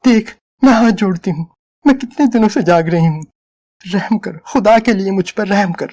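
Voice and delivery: pleading. A narrator's reading. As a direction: beg him with folded hands. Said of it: "औरत की आवाज में इल्तिजा थी".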